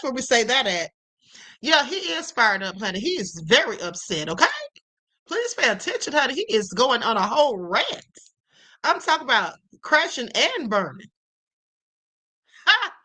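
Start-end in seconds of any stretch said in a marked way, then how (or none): none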